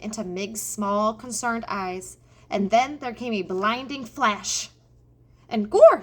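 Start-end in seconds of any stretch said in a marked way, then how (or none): 5.5-6.0 high, very loud cry; shouted exclamation. A narrator's reading. Mig striking at the mouse with the knife, shouting. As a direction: bellow it as the knife comes down. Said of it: "shouted Mig".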